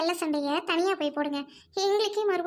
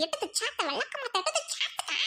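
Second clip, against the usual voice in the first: high, laughing egg-on; laughing taunt; 4 semitones higher, 6 dB quieter than usual. Acted. Gleeful sister laughing as she urges a beating. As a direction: cackle while urging her to hit. Egging sister on against rival.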